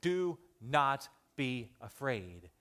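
slow and deliberate, each word weighed; calm them, very slowly; slow, gentle. Shown as a demonstration. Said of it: "I want you to hear these words".

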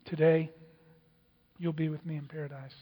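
low, hushed assurance; gentle promise. Acted. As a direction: promise it very softly to the dying man. Jesus answers the dying criminal.

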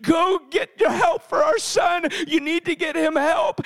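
high, insistent acted plea; frantic urging. Wife sending her husband for their son's healing, frantic. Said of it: "it's frantic in the home".

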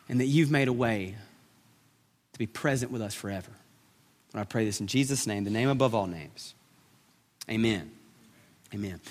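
hushed, slowing prayer that fades at the end. Real, not acted. Pastor praying to the Father before the sermon, hushed prayer.

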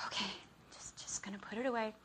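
whispered self-restraint; forced calm. Retold or imitated. Wife putting laundry away, whispering with forced calm. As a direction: whisper it, holding the temper in.

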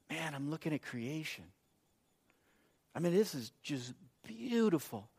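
very soft, unhurried wonder; hushed awe. Retold or imitated. Husband staring out the car window at creation, hushed. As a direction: murmur it, lingering on the beauty. Retells his awed answer in car.

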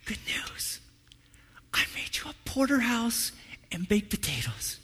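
soft unvoiced whisper; whispered wife. Wife telling husband dinner's ready, whispered. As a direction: murmur it sweetly to him. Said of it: "she goes".

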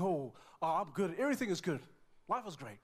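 imitated over-bright reassurance; brave front. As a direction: insist all is fine from behind a mask. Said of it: "putting up a brave front".

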